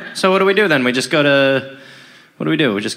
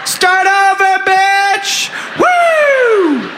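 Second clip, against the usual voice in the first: rowdy shout; 13 semitones higher, much slower than usual. Acted. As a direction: whoop like a drunk frat guy. Drunken rowdy party brother.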